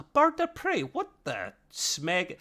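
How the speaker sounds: high, baffled squeak of confusion; mock bewildered double take; confused fan seeing the wrong ship. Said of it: "but I was like".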